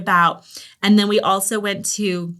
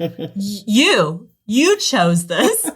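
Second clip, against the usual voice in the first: slow, laughing, a bit louder; laughing retort; 3 dB louder, much slower than usual. Retold or imitated. Parents laughing back at the teen who picked the walk. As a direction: laugh the words back at him. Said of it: "we're like".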